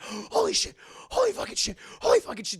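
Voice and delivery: high gasped swearing on the run; panicked retold exclamations, pitch jumping; mock panic. Captive fleeing her attacker, acting high gasped panic.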